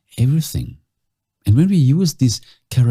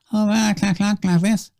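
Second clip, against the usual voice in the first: much higher, squeaky demo voice; 12 semitones higher than usual. Shown as a demonstration. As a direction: float the pitch way up.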